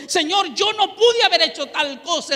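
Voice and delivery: pleading through tears to God; tearful plea. Retold or imitated. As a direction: cry it out to God. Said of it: "Yo le decía con lágrimas".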